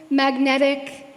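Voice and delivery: ordinary talk, nothing marked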